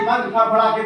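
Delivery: plain reading voice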